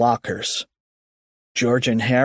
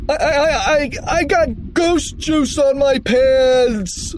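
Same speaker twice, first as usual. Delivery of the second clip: high, slow stuttering wail; tearful wail; 13 semitones higher, 3 dB louder, slower than usual. A narrator's reading. Loogie bursting into tears over pants, high wail. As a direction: wail it, stretching the last word. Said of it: "Loogie wailed as he burst into tears".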